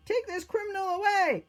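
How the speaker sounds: very high, loud imperial shout; furious-sounding ringing command; crying out a sentence. A narrator's reading. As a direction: condemn the prisoner at full voice. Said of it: "changed his mind and cried".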